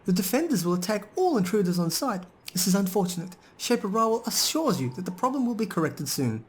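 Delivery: high, robotic recorded-announcement voice; golem's second voice. Acted. Player voicing the golem's second voice to the party. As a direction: read it up high like a stuck machine message. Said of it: "The golem says in the second voice it used".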